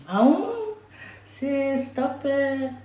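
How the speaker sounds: high, mock-flattered squeal; high teasing "stop it, sis"; playful sass. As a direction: brush off the compliment like a sassy friend. Self-flattery, playful reply to self.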